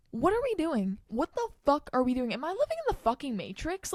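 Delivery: loud, high exasperated outburst; angry. Real, not acted. Podcaster ranting about the trillion dollars, angry. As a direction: burst out in angry disbelief. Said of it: "I'm getting angry".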